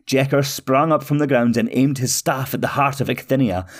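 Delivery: ordinary delivery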